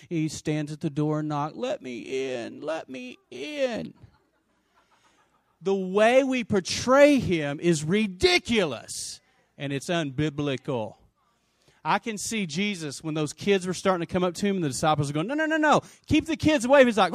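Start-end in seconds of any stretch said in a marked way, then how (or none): 1.6-3.9 soft mock-pathetic begging; mock feeble pleading. Mock meek knocking Jesus. 15.3-16.9 high, urgent shooing; mock officious alarm; fussing disciples. Disciples shooing children from Jesus.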